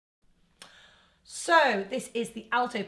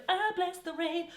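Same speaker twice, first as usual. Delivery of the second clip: mum joining in; 8 semitones higher, 5 dB quieter than usual. Pretend. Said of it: "your mum can do".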